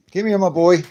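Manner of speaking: high, loud excited call to the dog. Real, not acted. Owner calling his dog over, high and excited.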